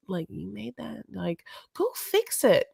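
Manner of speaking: whispered scolding aside, as the mom; acted whispered telling-off; mock whisper. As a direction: hiss it under your breath like an embarrassed mother. Voicing mom's aside to her son.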